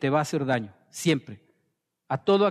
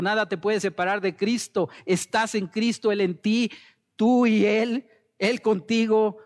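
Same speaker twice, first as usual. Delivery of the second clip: fervent preaching, pitched well above usual talk; prédica ferviente; 6 semitones higher than usual. Acted. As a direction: preach it insistently on a sustained high pitch. Preaches with rising fervour. (predicación ferviente, con tono elevado)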